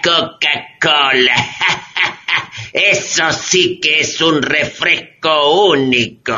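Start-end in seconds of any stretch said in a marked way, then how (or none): 1.4-2.7 soft chuckle. Acted. Man laughing at the drink.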